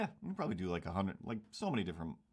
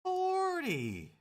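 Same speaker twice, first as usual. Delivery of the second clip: high drawn-out wail on forty; mock-lament it high and long; dramatic wail; 5 dB louder, much slower than usual. Dramatic lament about turning forty.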